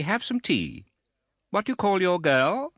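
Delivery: put-on high, imperious old lady voice; stern aunt. A narrator's reading. Stern aunt sizing up the widow, high and imperious. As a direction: look down on her from a height. Miss Betsy speaking.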